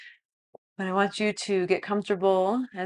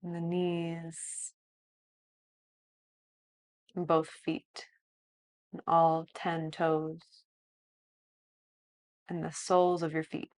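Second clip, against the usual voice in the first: softer, slow body-scan guidance; guided meditation; 8 dB quieter than usual. Acted. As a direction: lead a calm body scan.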